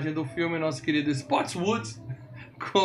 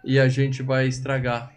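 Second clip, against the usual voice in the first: ordinary talk, nothing marked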